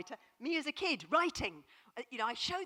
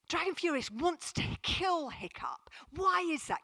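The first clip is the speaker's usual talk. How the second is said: put-on breathless boy's question; 6 semitones higher than usual. Boy's question, whispered high.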